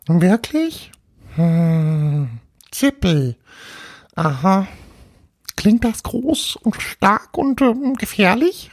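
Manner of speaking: high-pitched tiny ghost voice, wondering; amazed little ghost. Acted. Little ghost learns its name from Paul, high amazed voice. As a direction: marvel as a small ghost.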